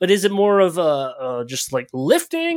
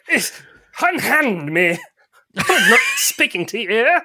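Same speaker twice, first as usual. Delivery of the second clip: haughty jockey; 5 semitones higher than usual. Acted. Jockey snubs the half orc.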